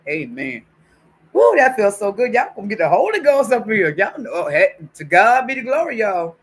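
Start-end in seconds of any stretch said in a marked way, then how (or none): 1.3-6.4 loud, high church praise; joyful praise shout. Real, not acted. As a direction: shout it like the spirit hit you. Catching the Holy Ghost.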